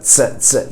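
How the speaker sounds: tse, tse with a crisp ts onset; tse, tse, clean ts burst; German Z sound. Shown as a demonstration. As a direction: say tse as a sharp t plus s. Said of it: "You hear that?".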